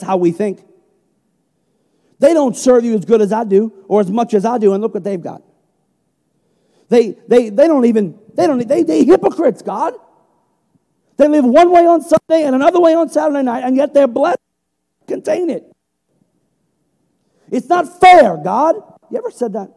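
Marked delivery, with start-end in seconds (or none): 2.2-15.6 raised, indignant grumbling at God; bitter complaint. Acted. 17.5-18.8 louder and higher cry of it's not fair; loud protest. Acted. Acted cry to God.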